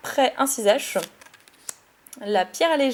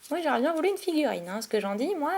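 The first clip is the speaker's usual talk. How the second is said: overexcited babble; 3 semitones higher, 6 dB quieter, faster than usual. Real, not acted. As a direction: gush it out in a rush. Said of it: "je parle comme ça, je suis hyper surexcitée". (parler vite, surexcitée)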